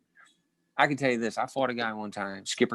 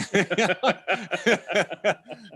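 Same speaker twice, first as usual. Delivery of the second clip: high, rolling laugh at his own punchline; long, high-pitched laugh; real laugh; 10 semitones higher than usual. Real, not acted. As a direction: crack up at your own story.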